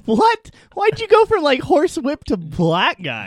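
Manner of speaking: loud, high, disbelieving; shocked outburst. Real, not acted. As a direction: yelp it out in disbelief.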